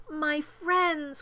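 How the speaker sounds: high, emotional call to friends; anxious cry. A narrator's reading. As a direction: cry out in relief and worry. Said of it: "cried the elephant".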